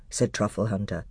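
ordinary delivery

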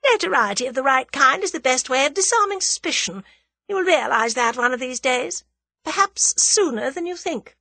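high, confident lady villain; high-pitched, amused self-assurance; self-assured scheming woman. A narrator's reading. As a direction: play her poised and unworried.

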